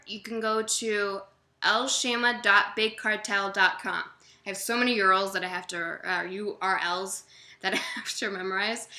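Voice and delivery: normal talking voice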